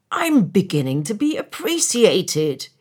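acted happy outburst; delighted cry. A narrator's reading. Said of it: "he cried".

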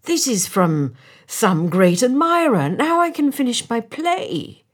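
loud, climbing to a high exclamation; acted joyful outburst; delighted cry. A narrator's reading. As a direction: build up in thrilled relief. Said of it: "he cried".

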